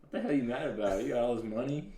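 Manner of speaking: loud, high mock outburst; mock angry yell. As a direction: yell it like you're baffled and mad. Mocking angry singer.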